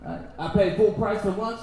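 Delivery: ordinary talk, nothing marked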